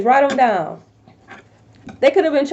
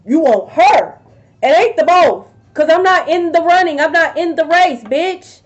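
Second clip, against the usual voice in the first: angry telling-off; 4 semitones higher, 4 dB louder than usual. Acted. Telling off the cheating partner.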